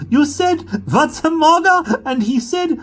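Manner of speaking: very high, loud, slow and shrieking; a girl hooting about the cat; laughing scream. A narrator's reading.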